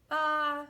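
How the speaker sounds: loud high baa; sheep baa. A narrator's reading. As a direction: bleat it like a sheep. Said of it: "said Sheep".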